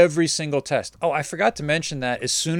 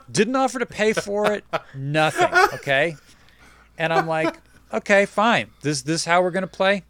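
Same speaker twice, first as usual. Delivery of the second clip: indignant; 6 semitones higher than usual. Real, not acted. Indignant retelling, pitch high.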